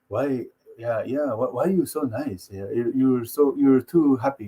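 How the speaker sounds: low, soft, puzzled fighter; Ngannou. Retold or imitated. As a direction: imitate a deep-voiced man questioning niceness. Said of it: "Ngannou's like".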